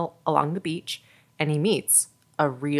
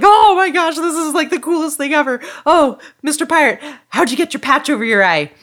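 put-on very high, loud kid gushing; excited little boy; 11 semitones higher, 7 dB louder, faster than usual. Little boy thrilled by the pirate's stories, excited. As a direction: squeal it like a thrilled child. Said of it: "And the little boy's like".

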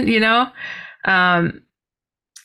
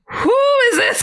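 high, startled squeak of a question; 3 dB louder than usual. Retold or imitated. Seeing the man on the driveway, a high startled cry. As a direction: gasp out who he is in shock.

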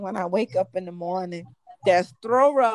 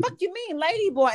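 sharp, higher offended comeback; offended retort; 6 semitones higher than usual. Retold or imitated. Lady boy snapping at the comedian, offended. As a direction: snap back, insulted.

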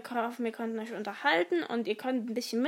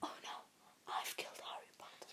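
whisper; 22 dB quieter than usual. Acted. Whispered acted line.

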